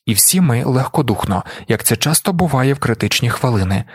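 plain reading voice

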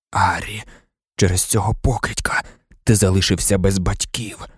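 low, subdued, lightly croaked; hoarse rasp. A narrator's reading. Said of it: "прохрипів Блек". (тихо, низько, з легкою хрипотою)

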